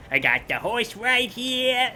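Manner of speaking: high, slow New York gangster; New York accent. Retold or imitated. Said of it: "I could do that New York voice".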